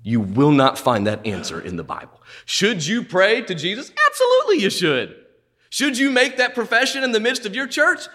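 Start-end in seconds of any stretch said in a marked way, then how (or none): 4.1-5.2 ABSOLUTELY flung out high and falling; Absolutely ringing up high, then dropping hard; emphatic absolutely. Acted. Preacher to congregation, booming high ABSOLUTELY. Preacher answers his own question.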